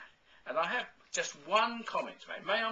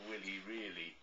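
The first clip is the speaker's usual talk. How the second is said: laughing; 8 semitones lower, 7 dB quieter than usual. Real, not acted. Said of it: "I don't mean to laugh".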